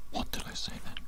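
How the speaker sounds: ordinary talk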